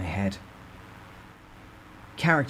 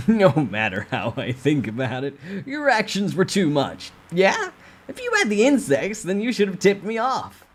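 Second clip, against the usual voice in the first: sneering boss; 10 semitones higher, 6 dB louder than usual. Acted. Boss reproaches narrator.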